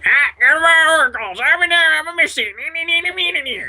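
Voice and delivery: very high, squealing parody of a teammate; mock yell that runs on into squeaky gibberish; exaggerated yelling impression. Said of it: "my impression of you yelling at me".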